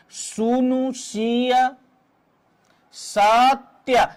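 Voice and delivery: very slow, each syllable drawn out. Shown as a demonstration. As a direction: sound out the verse beat by beat. Sounds out verse again for counting. (एक-एक मात्रा खींचकर, धीरे-धीरे)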